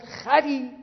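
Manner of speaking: a shouted insult at the other driver; angry yell. Retold or imitated. Driver yelling at the cleric after a crash. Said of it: "داد زد".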